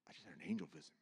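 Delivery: very quiet, low murmur to self; retold hushed shock; stunned hush. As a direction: barely voice it, in disbelief. Stunned self-talk before losing it.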